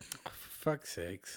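whispered slow mock exasperation; exasperated whisper. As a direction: breathe it out slowly, fed up. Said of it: "she's probably gonna be like".

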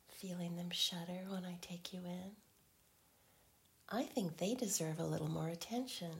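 intimate teasing whisper. Acted.

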